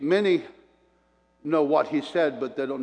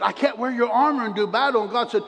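rising louder and higher to a cry; preaching build; 8 semitones higher, 4 dB louder than usual. Acted. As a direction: build to a ringing peak. Preaching climax quoting God.

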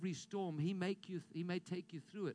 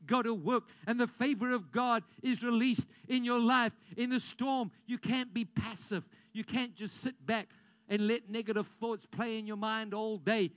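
rousing preacher calling out; full-force preaching; 3 semitones higher, 8 dB louder than usual. Acted. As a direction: drive it home to the congregation.

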